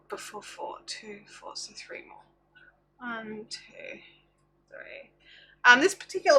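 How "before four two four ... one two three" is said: counting stitches under breath; whispered counting. Real, not acted.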